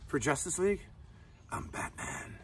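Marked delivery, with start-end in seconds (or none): 1.5-2.4 low gravelly whisper of Batman; hushed mock Batman. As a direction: growl it like the Dark Knight. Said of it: "did the voice of Batman".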